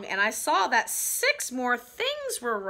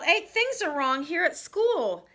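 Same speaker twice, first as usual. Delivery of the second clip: high-pitched insistent calling out; storybook yell; 7 semitones higher than usual. A narrator's reading. Child reporting wrong things at school, story yell. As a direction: read it as a yelled announcement. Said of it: "I yelled".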